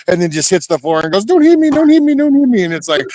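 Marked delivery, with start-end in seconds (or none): 1.3-2.6 very high, fast, panicked mock begging; mock whining. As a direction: squeal for mercy like a beaten coward, hammed-up. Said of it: "they whine and whine and whine".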